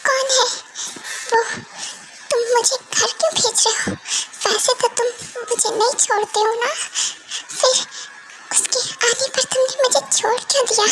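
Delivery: a wounded wife's tearful plea; tearful pleading. Acted. Wife pleads with husband, tearful. (आँसुओं भरी गुहार)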